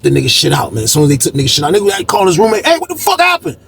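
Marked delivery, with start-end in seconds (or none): 2.7-3.6 high, startled demand; pitched-up shocked question; alarmed question. Retold or imitated. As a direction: ask like the news just hit. A prisoner asks the roommate.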